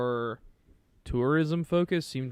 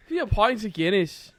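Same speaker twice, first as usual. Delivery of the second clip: loud, raised mock Irish brogue; Irishman; 6 semitones higher, 6 dB louder than usual. Friend demonstrating to co-host, hammed-up Irish accent. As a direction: overdo an Irish accent to make the point. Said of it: "That's what that would be like".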